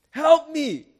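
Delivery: high, loud cry for help; desperate cry. Acted. Sinking Peter crying to Jesus, high and loud. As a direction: cry out to be rescued.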